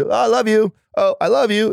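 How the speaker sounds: a high, breezy, automatic "oh, I love you"; mock throwaway. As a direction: chirp it out casually, without meaning. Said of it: "people just say it as a reaction".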